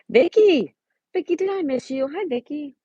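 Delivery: delighted call to a returning viewer; excited greeting. Real, not acted. Streamer greeting a viewer who just arrived, delighted high call.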